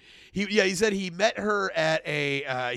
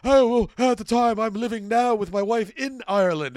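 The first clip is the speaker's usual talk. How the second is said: put-on loud, higher-pitched muddled British-Irish accent; hammed-up vaguely British and Irish accent; mock British-Irish accent; 5 semitones higher, 5 dB louder than usual. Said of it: "a British accent with Irish phrases".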